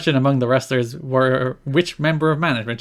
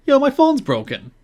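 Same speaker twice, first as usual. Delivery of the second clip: much higher mock voice, "Yo, my phone's broken" pitched way up; mock Goldilocks; 12 semitones higher than usual. Host mimicking Goldilocks on the phone store video, high mocking voice. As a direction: lampoon her with a high put-on voice. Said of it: "she's like".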